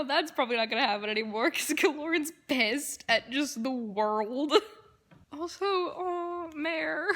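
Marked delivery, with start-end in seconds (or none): 5.4-7.1 crying. Real, not acted. Upset over character.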